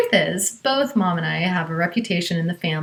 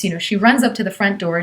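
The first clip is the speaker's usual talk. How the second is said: ordinary talk, nothing marked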